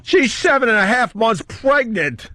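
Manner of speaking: high, drawn-out mock protest; exasperated protest. Defending himself to his jealous girl, high exasperated protest. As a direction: wail it in exaggerated self-defence.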